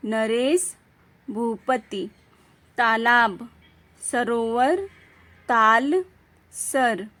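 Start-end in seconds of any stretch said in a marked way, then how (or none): none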